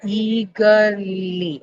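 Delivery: eagerly drawn out slowly, first syllable stressed, EA-ger-ly; slow stress demo. Shown as a demonstration. Teacher modelling a word for students, slow and stressed. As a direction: say eagerly slowly, leaning on the start. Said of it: "eagerly will work".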